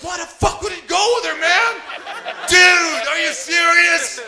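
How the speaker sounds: high, loud mock yell of an outraged American; outraged American guy. American guy next to him, loud and shocked. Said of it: "he's like".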